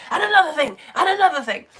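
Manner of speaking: mock over-keen lecturer piling on points; carried-away teacher. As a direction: imitate yourself getting carried away. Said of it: "I get a bit like".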